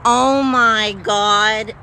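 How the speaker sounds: slow, loud, stretched oh my God; real stunned exclamation; drawn-out disbelief. Real, not acted. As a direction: let it drag out in stunned disbelief. Realizing Susie is the twentieth.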